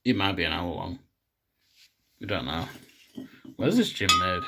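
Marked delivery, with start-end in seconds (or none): none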